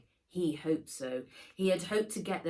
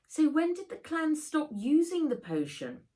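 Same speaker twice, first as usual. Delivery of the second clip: put-on girl's voice; 10 semitones higher, 4 dB louder than usual. A narrator's reading. Nat voiced asking question.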